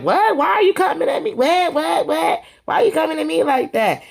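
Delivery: imitating a high indignant squeal; indignant what. Drew retorts after being told off.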